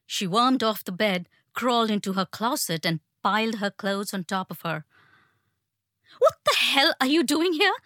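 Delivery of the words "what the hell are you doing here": higher, rattled angry challenge; shaken and angry. A narrator's reading. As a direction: snap at the intruder, frightened but furious. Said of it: "voice seemed shaken and angry".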